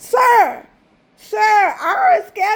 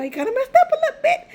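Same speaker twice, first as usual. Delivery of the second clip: much higher and quicker, playful; higher, faster mock repeat; 9 semitones higher, faster than usual. Real, not acted. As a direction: toss it off high and fast.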